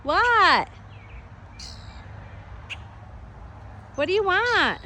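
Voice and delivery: high sing-song coaxing; coaxing pet voice. Real, not acted. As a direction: sweet-talk the bird down. Coaxing the bird.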